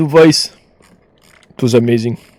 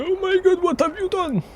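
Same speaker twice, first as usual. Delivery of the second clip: mimicking her shocked voice, pitched way up; imitating a shocked friend, much higher; high mock panic; 7 dB quieter than usual. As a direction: squeal it like she's horrified. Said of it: "She's gonna say".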